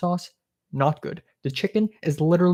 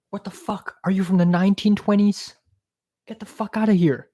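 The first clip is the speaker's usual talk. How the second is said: incredulous; 5 semitones higher than usual. Real, not acted. Reacting to host's old-fashioned ways.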